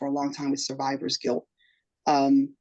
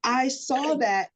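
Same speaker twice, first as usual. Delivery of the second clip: ordinary talk, nothing marked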